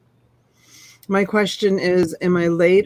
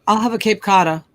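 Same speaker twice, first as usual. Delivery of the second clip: Cape Cotta, no R, Boston style; Boston accent. Shown as a demonstration. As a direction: order it as Cape Cotta, R dropped. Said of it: "No, I say Cape Cotta".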